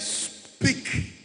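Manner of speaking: "speak" stretched out with a long hissed s; slow, punched "speak"; emphasis on speak. Shown as a demonstration. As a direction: draw out the s, then hit "speak". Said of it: "The emphasis there is".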